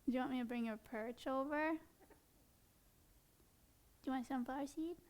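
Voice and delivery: quiet soothing pet talk; coaxing. Real, not acted. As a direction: win the bird back gently. Soothes the angry bird.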